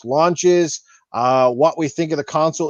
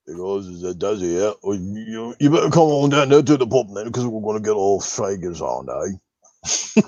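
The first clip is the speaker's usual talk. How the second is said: much lower, Australian-ish pub mate voice as Daz; Daz impression; 9 semitones lower than usual. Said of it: "I used to try doing a Daz".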